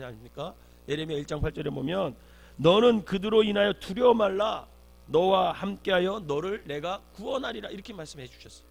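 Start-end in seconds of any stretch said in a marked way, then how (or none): 2.6-7.7 loud, slow divine proclamation; solemn promise, projected firmly; God's command. Acted. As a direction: declare it with slow, full-voiced authority. Preacher voices God's command to Jeremiah.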